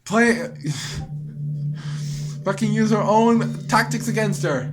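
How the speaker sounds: loud and high, triumphant; cackling triumph; gleeful outburst. Real, not acted. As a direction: crow over the win. Gleeful deleting Monika's file.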